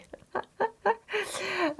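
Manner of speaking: light, high-pitched laugh; amused laugh. Real, not acted. Vlogger laughing at a viewer's question about her darling, amused laugh.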